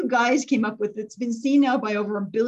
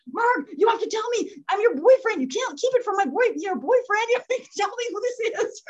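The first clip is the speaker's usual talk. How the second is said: high-pitched playful wheedling; mock pleading; 9 semitones higher than usual. Boyfriend begs nurse to share the secret, mock pleading. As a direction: imitate someone begging for gossip, voice raised high.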